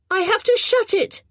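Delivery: put-on high, loud boy calling from above. A narrator's reading. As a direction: call it down from the window. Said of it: "he called down".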